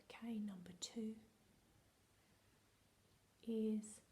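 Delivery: hushed, secretive whisper to the class; playful conspiratorial whisper; dramatic whisper. Acted. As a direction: let them in on a secret, whispering. Whispered test continues.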